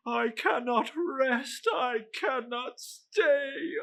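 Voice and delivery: high, crying ghostly lament; wailing ghost. Acted. Marley's ghost mourns to Scrooge in a high crying wail. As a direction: mourn a spirit that cannot rest.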